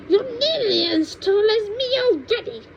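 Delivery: put-on high, cranky old woman lecturing; cranky grandma. Grandma scolds George about growing, in a high cranky voice. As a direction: scold like a mean little granny.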